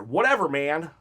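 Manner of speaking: ordinary talk, nothing marked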